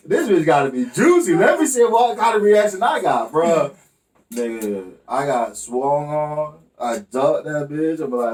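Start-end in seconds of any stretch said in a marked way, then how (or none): 0.1-3.7 higher, louder eager anticipation; gleeful mischief. Retold or imitated. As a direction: rub your hands before the drama.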